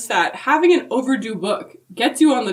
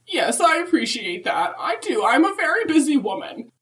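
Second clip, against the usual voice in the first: put-on higher, sweet old lady voice; Mrs Claus voice; 7 semitones higher than usual. Answering the podcast hosts as Mrs Claus, higher and sweet. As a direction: play a chirpy grandmotherly Mrs Claus.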